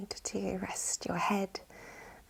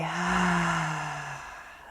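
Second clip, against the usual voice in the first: long, airy sigh fading out; open-mouthed exhale of release; big letting-go sigh. Shown as a demonstration. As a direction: let the whole breath fall out through the mouth. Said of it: "a big letting go sigh".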